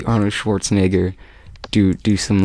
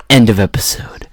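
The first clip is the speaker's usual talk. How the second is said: whispered sign-off. Retold or imitated. Mock announcer sign-off, whispered.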